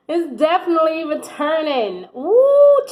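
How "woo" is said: high, loud joyful whoop; excited whoop. Real, not acted. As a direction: whoop with delight. Thrilled by the love card.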